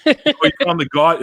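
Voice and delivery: high mocking sneer; mock him with fake amazement; sarcastic. Real, not acted. Mocking commenter.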